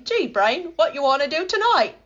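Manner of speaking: high, excited cartoon-mouse question; Pinky impression. Reminded of the cartoon, playfully quoting Pinky, high. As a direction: pitch it up like a goofy cartoon mouse. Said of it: "the little mouse Pinky asks".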